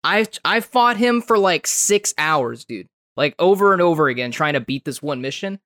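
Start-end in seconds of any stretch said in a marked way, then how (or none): 0.0-2.6 slow emphatic. Real, not acted. Exasperated emphasis on difficulty.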